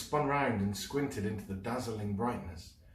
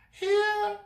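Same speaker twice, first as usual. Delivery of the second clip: high loud call; calling back; 8 dB louder than usual. Acted. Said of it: "she called back".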